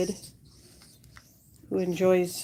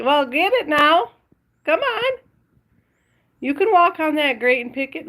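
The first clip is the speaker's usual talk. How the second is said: raised, higher coaxing; higher, encouraging pet talk; urging the dog; 6 semitones higher, 5 dB louder than usual. Real, not acted. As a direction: egg the dog on in a high voice.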